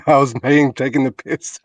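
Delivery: amused, laughing either side of the words. Real, not acted. Admitting to the others he was only teasing, laughing. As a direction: talk through a laugh. Laughs off his own joke.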